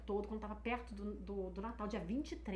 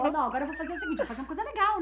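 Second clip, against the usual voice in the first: laughing; 5 semitones higher, 9 dB louder, much faster than usual. Real, not acted. Rindo ao planejar a surpresa.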